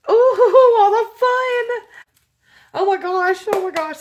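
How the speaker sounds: very high, loud ooh of joy; delighted squeal. Real, not acted. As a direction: coo over the goodies. Delighted at the box contents.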